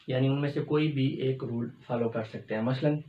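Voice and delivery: normal talking voice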